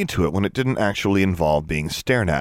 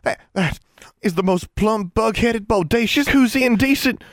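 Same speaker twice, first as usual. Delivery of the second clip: climbing higher and louder, flustered Ozark twang; sputtering Southern outrage; 3 dB louder than usual. A narrator's reading. The twins sputter at the scheme, outraged and twangy. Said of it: "began sputtering".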